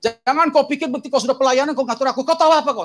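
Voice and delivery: high-pitched scolding tirade; acted Medan-style outburst; angry Medan father. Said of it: "gaya Medannya".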